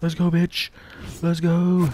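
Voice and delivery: high, loud hype shout; hyped cheer. Real, not acted. Gamer cheering loudly at his own play. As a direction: yell it out in triumph.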